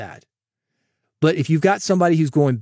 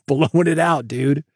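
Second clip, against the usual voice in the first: overwhelmed listener; slower than usual. Retold or imitated. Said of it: "You're like".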